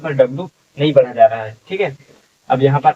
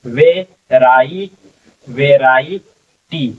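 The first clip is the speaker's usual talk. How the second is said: slow syllable blending; much slower than usual. Shown as a demonstration. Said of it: "ठीक है".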